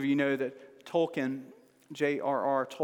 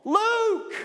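Luke yelled much higher and louder, rising; cheering a name; 6 dB louder than usual. Shown as a demonstration. Father cheers his son on, loud and high. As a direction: cheer him on from the sidelines. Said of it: "I'm cheering them on".

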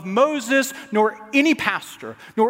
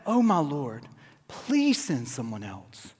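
lower, soft, slow plea; hushed begging Moses; reading Moses' plea, soft and slow; pleading softly; 7 semitones lower, 6 dB quieter, slower than usual. Acted. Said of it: "But he said".

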